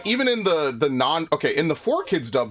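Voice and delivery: ordinary talk, nothing marked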